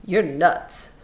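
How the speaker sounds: dismissive, mildly scornful. A narrator's reading. Crooks rejecting the rabbit dream, scornful. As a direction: brush him off with scorn. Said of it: "Crooks was scornful".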